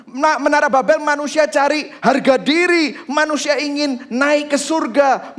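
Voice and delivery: high, loud, emphatic sermon delivery; full-force preaching. Real, not acted. Preacher contrasts Babel and Pentecost for the congregation, full-force preaching. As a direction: preach it with rising urgency.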